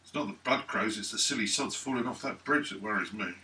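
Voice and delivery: ordinary talk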